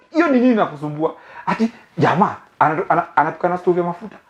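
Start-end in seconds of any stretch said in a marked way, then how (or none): none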